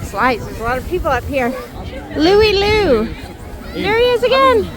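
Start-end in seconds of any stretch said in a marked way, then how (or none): 2.2-4.7 calling out. Real, not acted. Calling out to a child.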